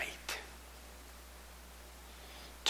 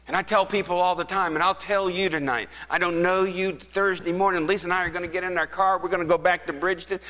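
high-pitched, forceful sermon delivery; impassioned preaching; 8 semitones higher, 5 dB louder than usual. Real, not acted. Preacher urges congregation to church, impassioned and high. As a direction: press the appeal home with mounting force.

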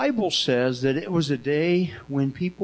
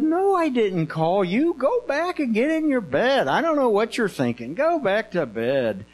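mock exasperation; 6 semitones higher than usual. Eli sending Samuel back to bed, play-acted exasperation. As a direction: send the child off, drawing it out.

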